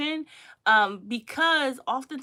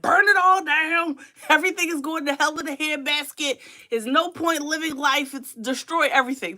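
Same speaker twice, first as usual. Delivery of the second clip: mock nihilist; 5 semitones higher, 4 dB louder than usual. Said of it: "a nihilist is somebody who's like".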